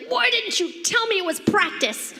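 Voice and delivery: put-on high, whiny child's complaint; indignant kid. Little brother storming home, high and indignant. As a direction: squeak it out like a betrayed little boy. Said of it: "He's like".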